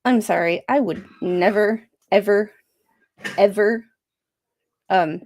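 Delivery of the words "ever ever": EVER, ever, each punched louder and set apart; stress on EVER. Real, not acted. Streamer refusing that counselor's advice, stressing each EVER. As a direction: hit each "ever" hard, with a pause between. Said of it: "emphasis on ever".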